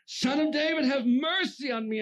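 high, loud, drawn-out plea; desperate acted shout for mercy; screaming out. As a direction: scream it out across a crowd. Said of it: "he just starts screaming out".

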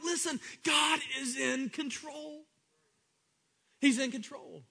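high, slow shout: Listen, God is in control; preacher thundering reassurance; preaching shout. Real, not acted. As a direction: call it out over the congregation, slow and high. Preacher calls out.

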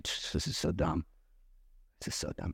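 very quiet, low grumble; muttered complaint. Acted. Preacher voicing a private grumble under her trial, hushed mutter. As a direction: drop to a near whisper, grumbling to yourself.

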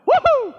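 very high, loud whoop of excitement; fired-up whoop. Real, not acted. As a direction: let out a big celebratory cheer. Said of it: "I'm getting fired up".

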